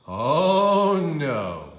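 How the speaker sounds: loud groaning oh, no; dad's loud dismay; hammed-up horror. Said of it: "said her father".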